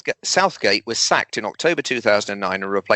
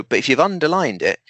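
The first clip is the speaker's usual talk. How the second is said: ordinary talk, nothing marked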